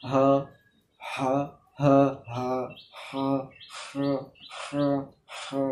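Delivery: হা with throat pressed inward. Shown as a demonstration. Teacher drilling the pressed throat ha. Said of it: "এমনিভাবে practice করবেন".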